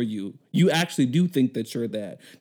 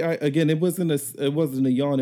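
ordinary talk

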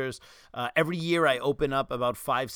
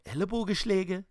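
put-on Ellenbogenschläge in the speaker's old Swabian dialect; Swabian accent; 5 semitones higher than usual. Shown as a demonstration. Speaker recalling how he used to say Ellenbogenschläge in Swabian. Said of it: "I would say".

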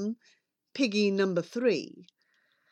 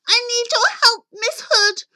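high, hurried piggy plea; desperate little pig on the phone; pleading pig; 12 semitones higher than usual. Acted. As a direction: beg for help in a high, urgent voice. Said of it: "The pig cried".

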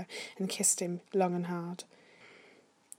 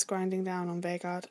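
ordinary delivery

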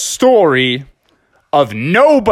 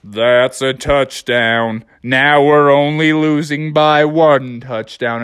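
gloomy Eeyore; 2 semitones lower, slower than usual. Acted. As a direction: sigh it out like Eeyore. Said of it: "and said".

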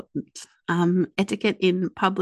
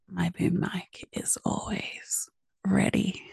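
slow breathy whisper; 5 dB quieter, slower than usual. Pretend. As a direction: breathe it into the mic. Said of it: "That's a different podcast".

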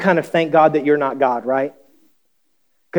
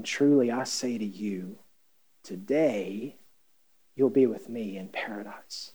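tender Jesus; 5 semitones lower, 11 dB quieter than usual. Acted. Jesus answering the thief.

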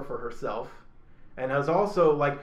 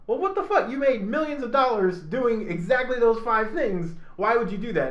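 acted higher-pitched outrage at being betrayed; indignant disbelief; 6 semitones higher than usual. Betrayed apprentice confronting the hypocritical teacher, indignant disbelief. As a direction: play an apprentice indignantly demanding an explanation.

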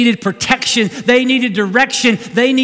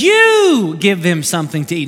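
"You" pushed out firmly, the rest level; stress on you; 4 semitones lower than usual. Retold or imitated. Jesus commanding the disciples, with "You" firmly stressed. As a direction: lean hard on "You" as a command. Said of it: "the reason I emphasize that".

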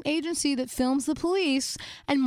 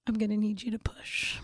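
put-on hushed, whispery solemn child; deadly serious; 3 semitones lower than usual. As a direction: confide a grave instruction, half-whispered. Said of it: "deadly serious".

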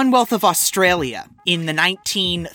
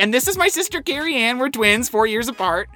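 higher, eager sister-introduction voice; lightly mocking higher introduction voice; mock self-introduction; 6 semitones higher than usual. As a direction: chirp out the twin joke, a little high. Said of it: "I'm like".

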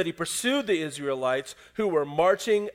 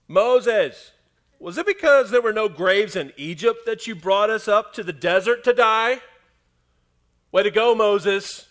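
loud, higher mocking whine at Moses; sarcastic complaint; 6 semitones higher, 11 dB louder than usual. Panicked Israelites to Moses, loud and sarcastic. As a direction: throw it at him, dripping with sarcasm. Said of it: "people decide sarcasm is the best plan".

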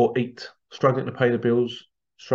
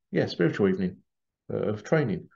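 usual speaking voice

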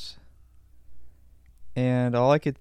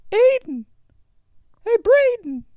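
put-on very high, loud kid shout, drawn out at the end; kid yelling; 5 dB louder, slower than usual. Kid shouts to his friend about the package, shrill kid yell. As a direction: holler it across the yard like a bratty kid.

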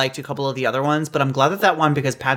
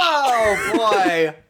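laughing. Real, not acted. After laughing fit.

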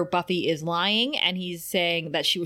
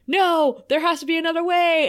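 high, loud, fast plea; desperate cry; 9 semitones higher, 5 dB louder than usual. Acted. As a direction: cry out against the verdict. Willow pleads with the demon.

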